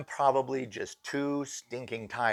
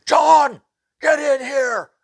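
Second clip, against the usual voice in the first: very high, very loud shout; panicked yell; 15 dB louder, slower than usual. Retold or imitated. Sheetrocker calling his boss upstairs: very high panicked yell. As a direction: scream for the boss. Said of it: "and he says".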